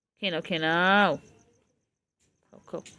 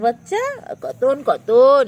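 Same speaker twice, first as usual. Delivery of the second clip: stretched coo; 6 semitones higher, 7 dB louder, much slower than usual. Real, not acted. Stretched loud baby-talk.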